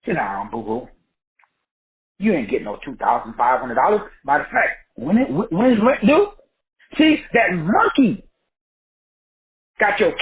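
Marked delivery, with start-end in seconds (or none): 0.0-4.0 low, dismissive brain talking back; the brain scoffing at a check, low and dismissive; mocking inner voice. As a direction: put yourself down in a low scoffing voice. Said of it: "the brain said".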